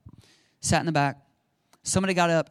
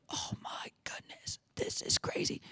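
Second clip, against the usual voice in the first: awed whisper; 15 dB quieter than usual. Retold or imitated. Newcomer amazed at a word in church, awed whisper. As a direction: whisper it in hushed disbelief.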